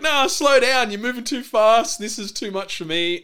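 high-pitched mock panic; freaking out. Girlfriend resisting hand-holding, acted freak-out. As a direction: imitate a flustered protest, voice pushed up. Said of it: "and she freaks out".

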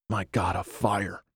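softly breathed exclamation; hushed amazement. A narrator's reading. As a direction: say it under your breath, awed. Starving man spots a fire.

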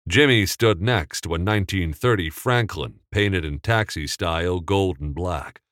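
ordinary delivery

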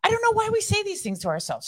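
high, quick, breathless girlfriend impression; imitating sweet friend. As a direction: rattle off the worries in a high chirpy imitation. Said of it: "She's like".

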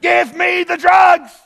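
high, loud, slow yelling demand for drugs; wife's yell. Retold or imitated. Husband recalls wife in labor yelling for drugs. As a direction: shout it like a woman in labor. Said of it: "my wife said".

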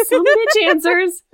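repeating it through a laugh, slow; can't hold back the laugh. Real, not acted. Laughing repeat of co-host's line.

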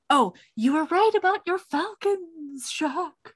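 high lightly voiced lady; mock heroine farewell. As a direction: say it pointedly, pitched well up. Heroine's coded farewell line.

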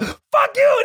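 very high, exploding curse; comic shriek of rage; mock furious yell. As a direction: imitate a caller screaming down the phone. Imitating the scammer answering in rage.

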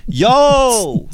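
a high, showy yo across the club; mock pickup yo. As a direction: call yo like a cocky pickup line. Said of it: "I'll run this game on you".

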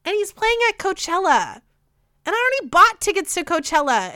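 venting real anger about him; angry rant. Real, not acted. Podcaster venting anger about [name] at Coachella, heated. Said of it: "it makes me so angry".

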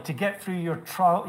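ordinary talk, nothing marked

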